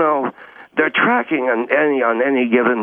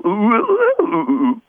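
high hooting computer noise; computer sound; 9 semitones higher, slower than usual. Acted. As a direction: mouth a beeping machine like a monkey hoot. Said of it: "So I'll go".

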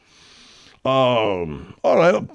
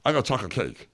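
mouth full; 4 semitones lower, 8 dB quieter than usual. Acted. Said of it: "you gotta finish up eating".

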